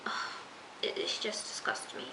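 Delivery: breathy whisper of disgust. Real, not acted. Viewer reacting to Ezra possibly being A, whispered disgust. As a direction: whisper it like it turns your stomach.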